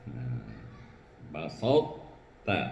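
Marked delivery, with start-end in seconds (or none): 1.3-2.6 "basatta" slowly, the heavy consonant merged into ta without a bounce; merged, no qalqalah. Shown as a demonstration. Teacher warning learners not to bounce the consonant, "basatta" slowly merged. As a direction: say "basatta" drawn out, no echo before the ta. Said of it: "Jangan dikolok-kolokkan".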